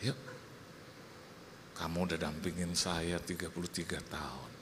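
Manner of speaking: low and soft, tenderly reassuring; coaxing husband. Retold or imitated. Husband reassuring his wife of many years, soft and tender.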